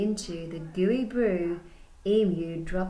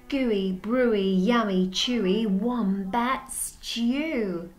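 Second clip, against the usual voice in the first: each word louder, drawn out slow at the end; savoured list; 2 semitones higher, 4 dB louder, slower than usual. Acted. Teacher savouring the stew words for the class. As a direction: relish every yummy word.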